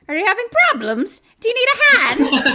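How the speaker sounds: high, loud, fast taunt; mocking leprechaun. Leprechaun taunts Jenny Ann through the phone. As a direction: sneer the offer gleefully. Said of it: "he's like".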